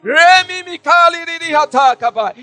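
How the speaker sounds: full-force preaching. Real, not acted. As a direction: build into urgent shouted exhortation and prayer.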